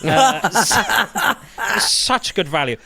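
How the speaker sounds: laughing through the words; words broken up by laughter. Real, not acted. Laughing at own joke.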